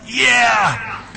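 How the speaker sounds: sudden high shout of joy; excited cheer. Real, not acted. Preacher cheering his team's win, excited shout. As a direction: whoop it out for his team.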